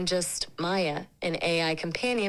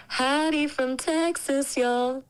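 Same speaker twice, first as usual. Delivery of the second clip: higher, slow Texan drawl; Texan accent; 6 semitones higher than usual. Shown as a demonstration. AI tries an accent on request, Texan drawl. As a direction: lay on a Texan drawl, stretching the ends. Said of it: "Can you do a Texan accent?".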